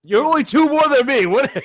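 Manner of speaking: mock outrage. Real, not acted. Outraged at his friend's count, loud mock protest. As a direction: protest loudly at the tiny gap.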